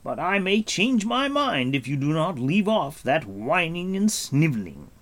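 dry and commanding; Gwydion's voice. A narrator's reading.

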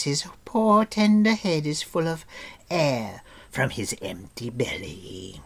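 whining, partly whispered creature; evasive creature. A narrator's reading. Gurgi dodging the question, whining and faint. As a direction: feign faintness.

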